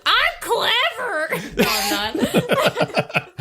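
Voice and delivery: high, slow laughing words. Real, not acted. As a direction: giggle through it. Laughing self-mockery.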